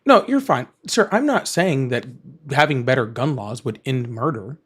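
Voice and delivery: ordinary talk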